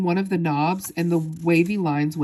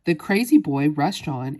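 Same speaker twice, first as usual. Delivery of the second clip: plain reading voice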